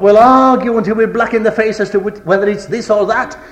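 high, loud pulpit rebuke, building in force; impassioned preaching. Real, not acted. Preacher rebuking brethren for arguing over truth, impassioned. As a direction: drive it home in full preaching voice.

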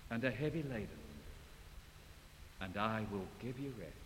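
soft, low, tender voice; serene. Retold or imitated. Christ inviting the weary, soft serene. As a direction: say it gently like a sweet invitation. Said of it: "sweet, beautiful, serene voice of Christ".

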